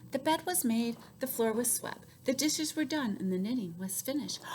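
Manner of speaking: ordinary delivery, nothing marked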